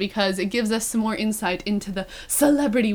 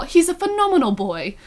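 higher-pitched mock British accent; 7 semitones higher than usual. Host quoting favorite line from the reading, mock British accent. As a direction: imitate Ben in hammed-up British, pitched up. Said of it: "where Ben was all".

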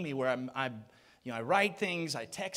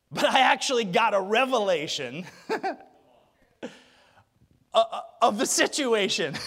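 words breaking into laughter; laughing while speaking; 7 dB louder than usual. Real, not acted. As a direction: laugh through the punchline. Laughing through line.

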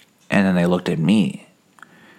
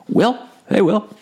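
high calling shout across the street. Retold or imitated. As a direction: call out to a friend who walks away.

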